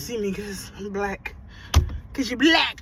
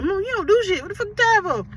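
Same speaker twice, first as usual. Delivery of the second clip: mock kid voice, higher; imitating her kids talking back; lightly voiced sassy kids; kids sassing mom; 9 semitones higher, 4 dB louder than usual. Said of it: "They be like".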